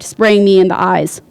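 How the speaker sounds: usual speaking voice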